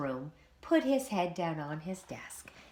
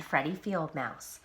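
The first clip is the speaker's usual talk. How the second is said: plain reading voice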